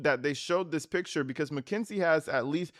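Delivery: ordinary delivery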